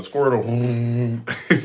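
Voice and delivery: playful battle cry of a cartoon water creature; Squirtle. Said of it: "I'll be like Squirtle out here".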